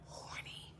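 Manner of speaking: a breathy whispered aside. Acted. Teasing her date with hints, whispered. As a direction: drop to a secret whisper.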